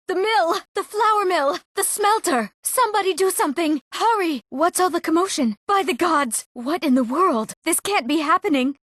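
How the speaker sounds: high-pitched, urgent cries of alarm; panicked alarm. Acted. Villager seeing the mill in trouble, crying out in panic. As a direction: call for help in mounting panic.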